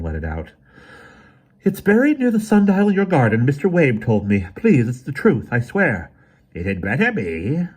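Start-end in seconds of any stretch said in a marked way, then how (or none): 1.6-6.1 loud, high desperate plea; pleading. A narrator's reading. Emerson pleads, lying. 6.5-7.7 villain mutter. A narrator's reading. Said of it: "muttered the Autarch with an evil grimace".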